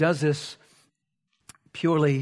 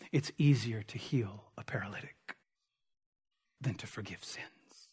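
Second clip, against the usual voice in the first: hushed whisper, barely voiced; 7 semitones lower, 13 dB quieter than usual. Real, not acted. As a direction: lean in and breathe the words. Preacher drops to a hushed aside.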